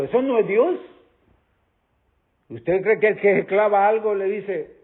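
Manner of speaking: normal talking voice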